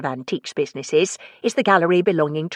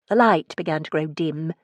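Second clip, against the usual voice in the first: ordinary delivery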